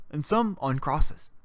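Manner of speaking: ordinary talk, nothing marked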